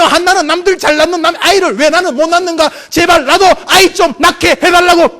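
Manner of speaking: high, loud, insistent outburst; impassioned pleading. Acted. Preacher acting out a childless woman's prayer, loud desperate pleading. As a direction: plead at full preaching force.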